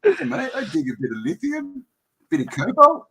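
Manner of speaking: put-on very high, squeaky botched South African accent; squeaked-out South African parody; accent gone awry. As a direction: lose control of a mock South African accent. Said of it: "don't know what happened to my accent".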